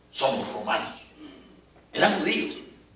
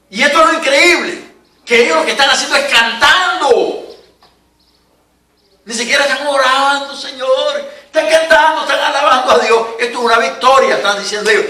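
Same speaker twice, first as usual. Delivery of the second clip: raised, driving preacher voice; preaching; 9 semitones higher, 5 dB louder than usual. Acted. Pastor urging the congregation in trouble, loud preaching.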